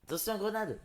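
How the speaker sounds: sudden high-pitched alarm call; grenade warning yell. Pretend. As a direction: shout a fake grenade warning. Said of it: "Non, je rigole".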